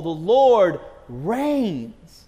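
drawn-out pulpit declaration; proclaiming. Real, not acted. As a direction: thunder it out from the pulpit, slowly. Preacher proclaiming God's rule.

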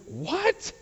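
high-pitched, astonished what; incredulous. Acted. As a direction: blurt it out in sheer disbelief. Acts thief stunned by forgiveness.

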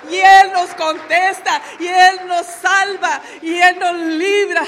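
loud, rising preacher's call with slow emphatic beats; preaching at full force. Acted. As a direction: lift the church with each line. Preaching climax to the congregation. (predicación a todo pulmón, fuerte y pausada)

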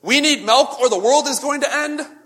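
mock teenager wailing that the world will end over milk; teenage drama queen. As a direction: play the melodramatic teen for laughs. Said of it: "a teenage drama queen in full force".

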